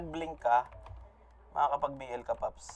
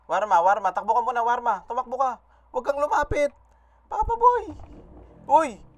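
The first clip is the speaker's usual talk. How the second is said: shouting that climbs higher and higher; urgent warning shout; 6 semitones higher, 6 dB louder than usual. Real, not acted. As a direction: yell a frantic warning to a teammate, pitch rising. Urgently warning teammate to run away. (pasigaw na babala, papataas ang boses)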